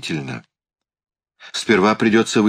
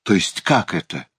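usual speaking voice

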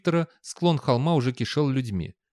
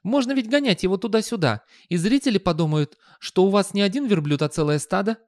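high, eager youth voice; enthusiastic youngster; 9 semitones higher, 3 dB louder than usual. A narrator's reading. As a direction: pitch an idea eagerly, voice high. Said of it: "с энтузиазмом предложил юнец".

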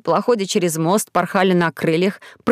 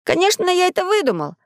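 high-pitched self-justifying; boy owning up defensively; making excuses; 10 semitones higher than usual. A narrator's reading. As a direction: defend yourself, voice jumping up. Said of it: "оправдывается Максим".

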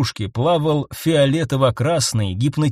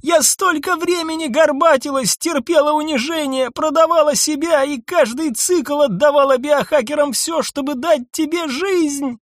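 desperate outcry; 12 semitones higher than usual. A narrator's reading. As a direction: throw her sacrifice back at him. Nissa pleading her sacrifice. (отчаянный крик)

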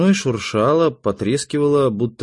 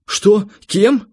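high, loud, urgent cries; shouted questions; 11 semitones higher, 5 dB louder than usual. A narrator's reading. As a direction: fire off two short questions, shouted high. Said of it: "закричал я".

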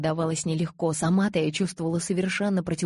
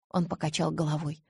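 ordinary delivery

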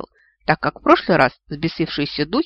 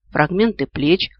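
plain reading voice

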